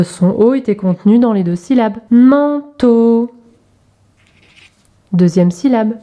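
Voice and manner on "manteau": "Manteau" split into two held syllables; drawn-out teacher's demonstration. Shown as a demonstration. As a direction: stretch each syllable for young readers. Syllable exercise, picture word.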